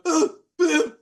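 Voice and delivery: high, loud cries with short breaks; exasperated cry. Acted. Game master acting Pim mid-stab, exasperated cries. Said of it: "He's going".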